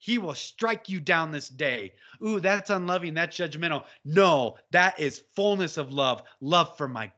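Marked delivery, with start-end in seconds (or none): none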